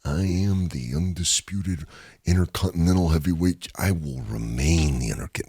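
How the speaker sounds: low, quiet raspy champion boast; Macho Man impression. Reciting Macho Man's title promo, low and quiet. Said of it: "where he's like".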